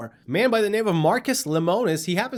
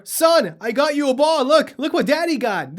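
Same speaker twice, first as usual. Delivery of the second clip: proud dad; 7 semitones higher, 4 dB louder than usual. Retold or imitated. Dad voiced handing ball to son.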